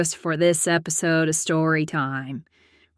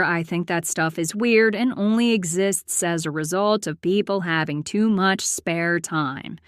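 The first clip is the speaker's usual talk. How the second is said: ordinary delivery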